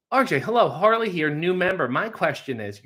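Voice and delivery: Harley's mock listener voice. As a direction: lightly ham up an eager new fan. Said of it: "Harley's doing a bit".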